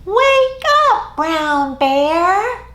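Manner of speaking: high, loud wake-up call. Acted. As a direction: call to wake a sleeping bear. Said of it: "will you help me call out".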